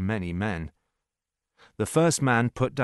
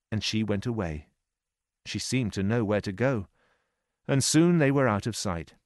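ordinary delivery, nothing marked

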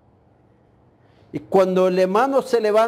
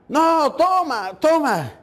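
slow, higher, pushy drinker's voice; insistent drunk man; 6 semitones higher, slower than usual. Retold or imitated. Drunk man pushing liquor on a shivering preacher, slow insistent voice. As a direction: drag out the offer, pressing the bottle on him.

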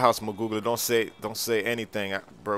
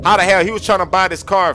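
outraged shout; 6 semitones higher, 7 dB louder than usual. Acted. Outraged at the thief.

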